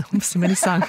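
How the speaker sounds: amused reply breaking into laughter; laughing. Real, not acted. Host answers her colleague's health remark, laughing. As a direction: laugh through the words.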